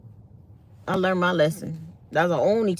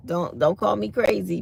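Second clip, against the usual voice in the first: normal talking voice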